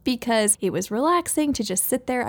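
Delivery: usual speaking voice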